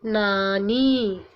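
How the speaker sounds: नानी drawn out very slowly; teacher reading नानी slowly; slow modelled word. Shown as a demonstration. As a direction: stretch नानी syllable by syllable. Teacher reads new word slowly.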